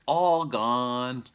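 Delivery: put-on high, loud little baby voice; baby bear voice. Shown as a demonstration. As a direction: model it for the kids like a toddler. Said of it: "Can you guys say that? Say".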